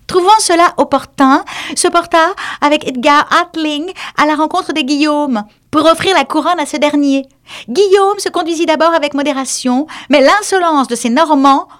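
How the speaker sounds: high, hurried lecturing mouse; mouse pressing on. Acted. The mouse ignores the duck and continues, high.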